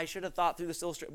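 usual speaking voice

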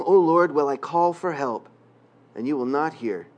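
ordinary talk

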